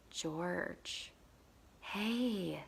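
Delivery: put-on soft, hushed call to a child; gentle coaxing. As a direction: coax a crying child quietly.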